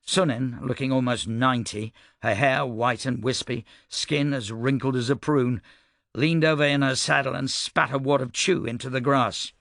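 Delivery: usual speaking voice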